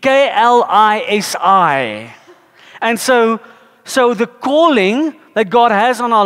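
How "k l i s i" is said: K L I S I, each letter slow and separate; spelling klisi out slowly for the congregation; spelled out. Shown as a demonstration. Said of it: "the Greek word for calling is klisi".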